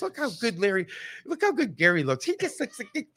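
high, gushing impression of his wife; imitating wife. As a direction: coo over Gary like a starstruck fan. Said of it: "She goes".

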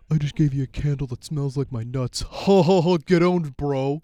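loud, gloating "Get owned, bro"; gloating prankster. Pretend. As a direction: taunt the friend, laughing. Acts a prank gift giver gloating.

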